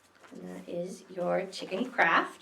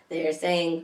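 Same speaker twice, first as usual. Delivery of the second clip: ordinary delivery, nothing marked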